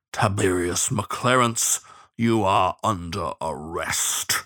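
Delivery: slow, low, weighty lawman voice; growling bloodhound sheriff. Acted. Bloodhound arresting Shen, slow growling voice. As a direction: pronounce the arrest slowly and heavily.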